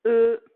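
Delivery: e sounded as eu, loud and held; isolated vowel sound. Shown as a demonstration. Teacher sounds out the vowel of nœud. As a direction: call out e as the eu of nœud. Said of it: "on entend le".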